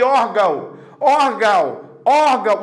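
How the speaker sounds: "órgão" with an open oral ending, as "órgau"; som aberto, sem nasal. Shown as a demonstration. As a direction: say "órgão" with the tilde ignored, sound only through the mouth. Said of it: "o som tá aberto". ("órgão" com final aberto, sem nasalizar, tipo "órgau")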